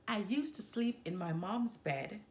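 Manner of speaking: plain reading voice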